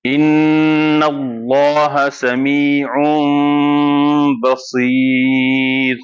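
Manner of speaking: ان اللہ سمیع بصیر very slow, with a nasal hum on the N; with ghunnah. Shown as a demonstration. Teacher recites the verse slowly with ghunnah. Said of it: "غنہ بھی کریں گے".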